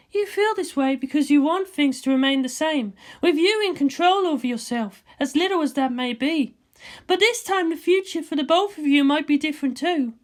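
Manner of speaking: a cool scientist ribbing her friend; Ritsuko's voice. Acted.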